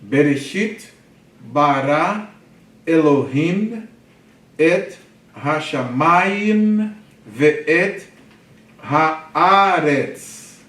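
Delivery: slow, projected Hebrew, word by word; didactic verse reading. Shown as a demonstration. Teacher reading Genesis in Hebrew to class, slow and projected. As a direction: read it out deliberately, stretching the stressed syllables.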